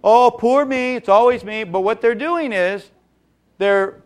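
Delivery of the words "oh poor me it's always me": higher and louder, hammed-up poor-me wail; milk the self-pity; mock martyr lament, pitched up and loud; self-pitying monologue. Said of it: "this little monologue of".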